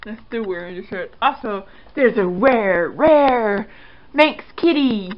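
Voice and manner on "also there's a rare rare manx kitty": excited. Real, not acted. Excited cat announcement.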